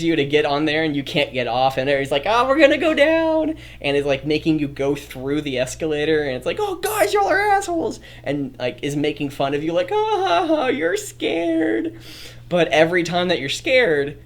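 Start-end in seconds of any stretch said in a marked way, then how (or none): 2.3-3.5 high, mocking teasing voice; high mock friend dragging you along; mock pushy friend. 6.6-8.0 squeaky high complaining voice; high squeaky mock complaint; high mock whine. Voicing scared friend. 9.9-12.0 high, slow teasing cackle; slow high taunting mockery; mock taunting laugh. Mocking scared friend.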